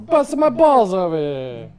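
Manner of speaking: loud, hammed-up New York accent; mock New York accent. Joking to co-host about Sicily, loud mock New York accent. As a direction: raise it into a stock New York wiseguy accent. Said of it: "That's New York".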